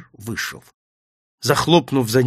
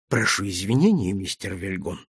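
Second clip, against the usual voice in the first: low, soft, composed apology; quietly polite, voice dropped; calm; 7 semitones lower, 5 dB quieter than usual. A narrator's reading. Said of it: "спокойно произнёс он".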